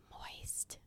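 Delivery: barely audible whispered word; hushed, voiceless whisper. Real, not acted. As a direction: whisper it to yourself. Repeats a word she hates.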